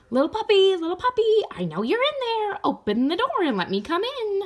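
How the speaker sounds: very high, tiny bug voice, wheedling; a little flea coaxing the puppy to open up; squeaky flea voice. Acted. Flea voice at the door.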